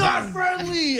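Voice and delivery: high, drawn-out imitation holler; mock warning yell. Dog owner repeating the warning in a stretched mock shout. Retold video prankster yelling at people.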